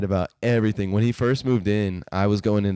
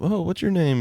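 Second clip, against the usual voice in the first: put-on kid voice; 10 semitones higher than usual.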